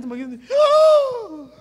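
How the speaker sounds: high, drawn-out "ah" of horror; mock horrified cry. Preacher acts out seeing the rotten hand, mock horrified cry. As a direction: let out a high, held cry.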